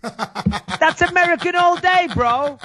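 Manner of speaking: high, drawn-out laughing crow; gleeful high-pitched boast. Real, not acted. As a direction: crow through laughter, stretching the words. Crowing about his accent demo.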